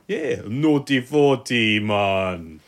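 slow, bad Jamaican accent. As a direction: drawl it in a mock Jamaican accent. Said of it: "That's my bad Jamaican".

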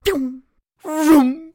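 high whooshing zoom noises; speed sound effects. Pretend. Bragging to friends about his running speed, high zoom sounds. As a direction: make the noise of something flying past.

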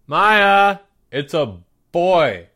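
loud, drawn-out shout to another room; calling out. A narrator's reading. Older sister calling Maya to the door, loud singsong. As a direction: holler it, stretching "boy".

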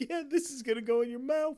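high, fast, giggly mock delivery; acting laughing-gas hysteria; imitated giddy laughing. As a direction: rush it out as if helpless with laughter. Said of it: "laughing so hard they can't talk".

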